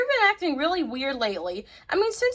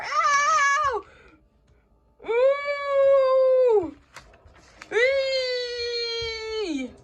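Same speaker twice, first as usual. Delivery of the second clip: high yelps of pain; 8 semitones higher, much slower than usual. Acted. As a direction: squeal as if scratched from inside. Duck scratching inside her.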